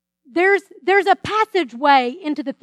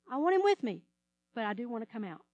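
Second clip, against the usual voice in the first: soft, lower, confiding repeat; quiet admission; 4 semitones lower, 11 dB quieter, faster than usual. Pretend. Believer quietly confessing a wish to escape trouble, soft. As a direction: admit it quietly, almost to yourself.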